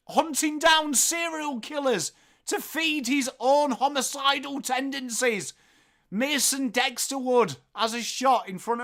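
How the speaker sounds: high, loud commentator call, slowing right down on the names; roaring footy caller bellowing the players' names; live play-by-play. Acted. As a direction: call it like the goal of the season. Hypes a goal as a caller.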